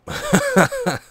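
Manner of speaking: high squeaky giggle of delight; giddy laugh. Real, not acted. Gamer getting his quarry, squealing with delight.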